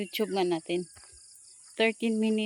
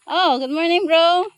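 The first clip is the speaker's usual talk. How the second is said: playful excited call; 8 semitones higher, 8 dB louder than usual. Real, not acted. Calling greeting to a dog.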